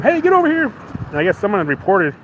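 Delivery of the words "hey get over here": mock cop. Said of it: "they go and get him".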